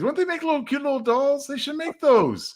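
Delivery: high, sweet doll-talk voice; cutesy voice. Pretend. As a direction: coo it like a toy ad. Imagining cute dolls.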